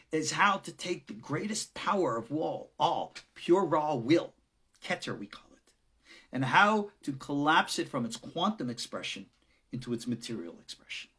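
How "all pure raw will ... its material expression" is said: usual speaking voice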